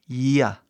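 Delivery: "ia" with a drawn-out ee gliding into a quick ah; long I, quick A. Shown as a demonstration. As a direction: stretch the I in "ia", touch the A briefly. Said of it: "I comprido e um A super rápido". ("ia" com I comprido e A super rápido)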